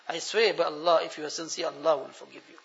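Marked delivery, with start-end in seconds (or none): none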